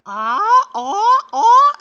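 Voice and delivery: loud, rising cries of ah; retelling a wild ride with shouts; excited yells. Retold or imitated. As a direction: yell it out in delight. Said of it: "so I was like".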